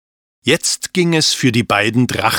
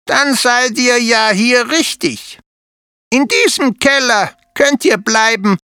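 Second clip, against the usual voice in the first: higher, friendly spider mother voice; kindly spider mother inviting them in; Ludovica the spider; 13 semitones higher, 3 dB louder than usual. A narrator's reading. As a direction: welcome the children like a proud hostess.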